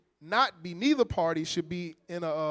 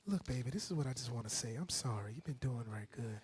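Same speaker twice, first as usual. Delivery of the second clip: put-on much softer, hushed sweet-talk to baby; soft apology; 7 semitones lower, 13 dB quieter than usual. Husband apologizing to wife, hushed and sweet. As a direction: coax her gently, nearly whispering.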